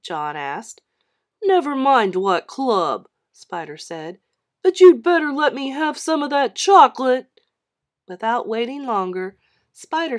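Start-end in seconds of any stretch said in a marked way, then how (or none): 1.4-3.1 louder, slow brush-off; bully dismissing the question; dismissive bully. Acted. 4.6-7.3 loud, higher bully warning; bully threatening for chocolate. Acted.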